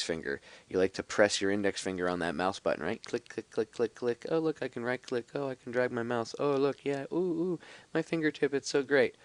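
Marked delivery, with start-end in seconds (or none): 3.0-9.2 high, sing-song gushing over nothing; higher-pitched sarcastic wonder; mock delight. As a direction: play a gleeful mouse user, mockingly. Mock happy mouse user acted out.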